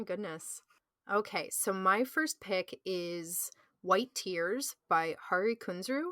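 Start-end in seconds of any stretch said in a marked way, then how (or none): none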